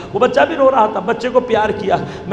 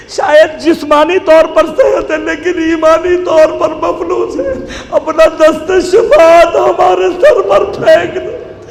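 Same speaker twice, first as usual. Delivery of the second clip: impassioned preaching plea; 5 semitones higher, 4 dB louder than usual. Real, not acted. Preacher's closing supplication.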